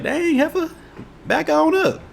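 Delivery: very high falsetto retort; mock-indignant squeal. Retold startled retort to coworker.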